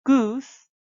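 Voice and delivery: "goose" said high and loud with a falling end; pron demo of goose. Shown as a demonstration. Teacher models "goose" for students to repeat, high and loud. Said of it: "so try again".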